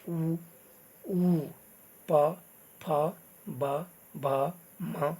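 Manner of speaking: "प, फ, ब, भ, म" made with the lips, one by one; lip sounds. Shown as a demonstration. Teacher showing learners which letters the lips produce, lip sounds. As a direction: round "उ, ऊ" and close the lips for "प, ब, म". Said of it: "होठों से किसके उच्चारण होते हैं".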